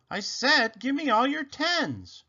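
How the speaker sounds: high-pitched, impatient demand; insistent demand. A narrator's reading. Rabbit demands cards from silent Robot, high and insistent.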